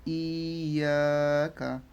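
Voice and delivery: very slow "ইয়া", then short "কা"; elongated "ইয়া কা"; drawn-out vowels. Shown as a demonstration. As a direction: stretch both halves of "ইয়া" before "কা". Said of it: "ইয়াটাকেও টান".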